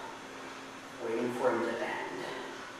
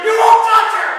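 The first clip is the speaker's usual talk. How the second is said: full-throated yell, much higher and louder; furious yell; 16 dB louder than usual. Retold or imitated. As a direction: scream it at him with everything. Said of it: "and I yelled".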